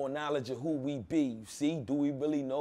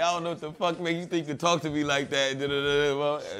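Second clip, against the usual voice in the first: loud, raised mock tirade; imitating an offended reply; 4 semitones higher, 6 dB louder than usual. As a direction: act out an indignant comeback, trailing into syllables.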